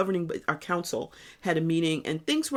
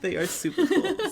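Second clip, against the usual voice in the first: laughing; 9 semitones higher than usual. Real, not acted. Laughing together.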